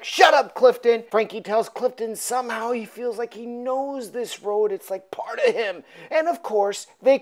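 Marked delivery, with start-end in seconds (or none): 0.0-1.0 mock angry shout. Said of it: "And Frankie's like".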